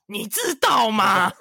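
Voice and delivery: high, very slow drawn-out impersonation; host mimics 菜头 answering with a catchphrase, high and slow; 菜头 impression. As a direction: stretch it out like 菜头 teasing. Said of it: "他用菜头回你".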